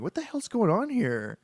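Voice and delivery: high incredulous cry; can't believe what he just read; real bewildered disbelief; baffled outburst. Real, not acted. Reader baffled by story.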